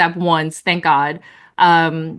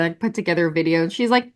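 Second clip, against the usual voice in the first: ordinary talk, nothing marked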